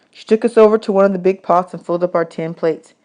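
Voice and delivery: ordinary delivery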